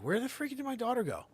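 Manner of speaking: put-on a dad suddenly missing his kid; alarmed.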